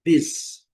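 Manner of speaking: isolated first syllable "Bis", pronounced "biz"; i sound for U. Shown as a demonstration. Teacher pointing out the letter U, short "Bis". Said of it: "olha o som da letra U".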